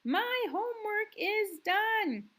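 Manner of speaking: high, loud shouted line; shouting. Shown as a demonstration. Teacher reading the sentence to kids as a shout. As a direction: shout it like someone thrilled their homework is done. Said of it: "Someone is shouting".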